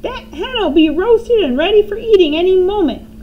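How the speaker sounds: high, loud gleeful crone shout; grandma shouting from the roof. A narrator's reading. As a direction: crow it out with nasty glee. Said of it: "Grandma shouted".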